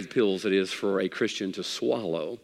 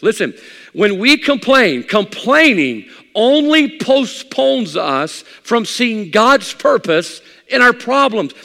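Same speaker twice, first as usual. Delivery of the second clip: high, loud pulpit delivery; full-force preaching; 10 semitones higher, 8 dB louder than usual. Acted. Preacher warning believers about the urge to complain, full-force preaching. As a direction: drive each point home to the congregation.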